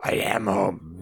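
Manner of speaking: creepy stage whisper; hushed, voiceless horror-movie line; eerie whispered imitation. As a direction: whisper it as a possessed film character. Echoing the eerie film line.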